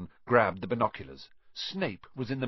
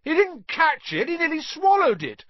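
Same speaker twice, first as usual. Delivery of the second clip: very high, loud howl of protest; loud, high-pitched wail of complaint; outraged howling; 8 dB louder than usual. A narrator's reading. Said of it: "Flint was still howling".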